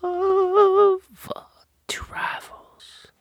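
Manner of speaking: slow, drawn-out announcer voice ending in a whisper; dramatic announcer intro. Acted. Host opening the podcast for listeners, slow dramatic announcer read. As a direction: introduce the show like a movie trailer.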